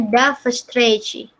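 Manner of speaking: Da встречи said slowly, "da fstrechi"; slow Russian demo. Shown as a demonstration. Teacher teaches see you again in Russian, slowly. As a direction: model Da встречи clearly and slowly. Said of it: "kamu bilangnya".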